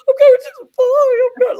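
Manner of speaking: high, loud squeal of disbelief; retold tearful squeal. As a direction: shriek it like you can't believe it. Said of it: "I was crying 'cause I was going".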